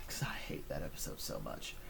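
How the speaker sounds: low, soft whispered confession; whispered aside. Real, not acted. Reviewer confiding his hatred of the episode, whispered. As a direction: whisper the hate like a secret.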